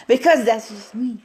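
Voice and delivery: usual speaking voice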